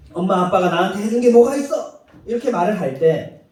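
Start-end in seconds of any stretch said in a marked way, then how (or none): 0.1-2.0 put-on petulant child's retort, pushed a bit louder; ungrateful child. Preacher voicing a spoiled child talking back to parents, petulant. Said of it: "못난 자식이 부모에게 하는 말".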